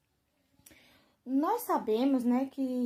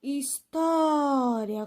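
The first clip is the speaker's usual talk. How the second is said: História very slow, with a long strong TÓ; stressed syllable demo; much slower than usual. Shown as a demonstration. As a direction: stretch out the TÓ in História. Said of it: "qual é a sílaba mais forte".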